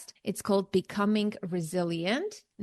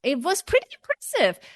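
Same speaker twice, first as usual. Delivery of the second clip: excited; 8 semitones higher, faster than usual. Real, not acted. Proud of finishing list.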